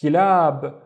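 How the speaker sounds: Kilab loud, long aa at the end; sounded-out word. Shown as a demonstration. Teacher joining the syllables, Kilab loud with a long vowel. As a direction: stretch the second syllable of Kilab. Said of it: "split it into syllables".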